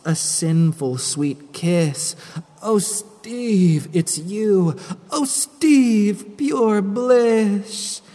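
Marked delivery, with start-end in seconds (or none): 2.6-4.7 swooning delight. Acted. Kissed by her dead lover. 5.1-8.0 "Oh, Steve" rising higher and louder in rapture; ecstatic swoon. Acted. Ruthie swooning over her ghost lover's kiss, higher and louder.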